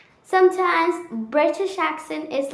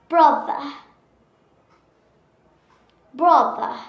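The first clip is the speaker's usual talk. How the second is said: British accent; 3 semitones higher than usual. Shown as a demonstration. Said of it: "time to revision".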